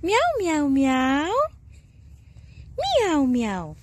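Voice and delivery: sing-song run of meows; playful human meowing; cat imitation. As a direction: mimic a kitty, starting high.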